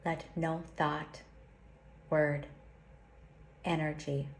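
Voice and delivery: slow, solemn prayer with long pauses; slow invocation. Acted. Teacher leads listeners through a protection invocation, slowly and solemnly. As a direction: intone each line like a sacred vow. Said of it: "I'll say it slowly".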